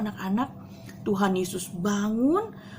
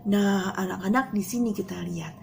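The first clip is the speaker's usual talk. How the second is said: normal talking voice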